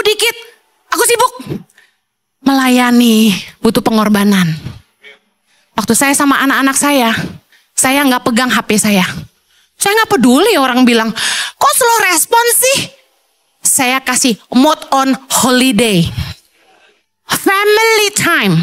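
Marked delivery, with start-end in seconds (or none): 0.9-1.6 high, soft, curt brush-off; dismissive brush-off. Retold or imitated. Distracted husband dismissing his family, curt and soft. 11.5-12.8 high, whiny mock complaint. People complaining about slow replies. 17.3-18.6 emphatic declaration. Real, not acted.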